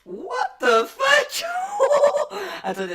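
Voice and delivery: shocked laugh through a pitch-shifting modifier; voice changer. Real, not acted. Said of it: "J'ai une voix modifiée?".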